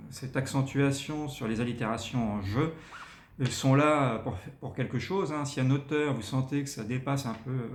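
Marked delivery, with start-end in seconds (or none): none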